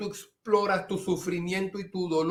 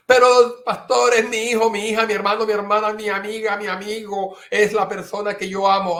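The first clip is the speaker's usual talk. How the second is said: high, loud pleading protest; pleading objection; 4 semitones higher, 5 dB louder, faster than usual. Retold or imitated. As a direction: protest to the pastor. Quoting a pleading listener. (protesta suplicante, aguda y fuerte)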